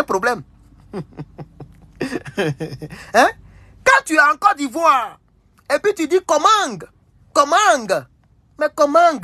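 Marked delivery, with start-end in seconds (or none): 6.3-9.2 "Comang" pitched high, ending on a sounded g; Cameroonian pronunciation. Retold or imitated. Host mocking how Cameroonians say comment, hard final g on "Comang". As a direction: mimic Cameroonians saying "Comang" with a hard g at the end. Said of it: "là, c'est Cameroun".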